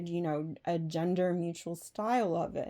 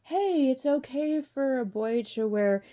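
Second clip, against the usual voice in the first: mock cheerful quote; 6 semitones higher, 4 dB louder than usual. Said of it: "that supports".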